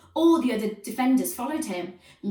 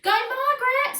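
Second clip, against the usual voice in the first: high, loud screech; very high, loud sideline screech; 7 dB louder than usual. A narrator's reading. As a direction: shriek from the sidelines. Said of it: "screeched Moody Margaret's mum".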